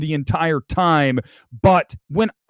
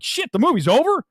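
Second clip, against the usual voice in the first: higher-pitched startled question; mock disbelief, pitched up; surprised viewer; 8 semitones higher than usual. Viewer caught out by the film ending, surprised.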